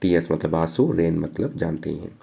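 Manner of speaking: normal talking voice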